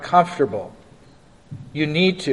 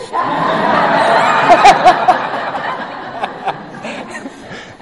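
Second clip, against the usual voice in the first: big burst of laughter; 10 semitones higher than usual. Real, not acted. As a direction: let out a real belly laugh. Pastor laughs at thirty seconds.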